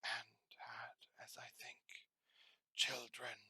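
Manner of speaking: hushed, breathy spirit voice; the Ghost of Christmas Past whispering to Scrooge; ghostly whisper. Acted.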